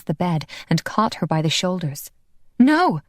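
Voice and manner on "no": high, loud cry of no; loud panicked refusal; sharp refusal. Acted. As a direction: push him off with a yell. Pulling away from Phil's grip.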